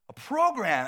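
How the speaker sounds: loud, high outburst; stressed exclamation. Retold or imitated. Retelling his stress over planning, loud panicked exclamation. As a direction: blurt it out in mock panic. Repeats it, feeling all this stress.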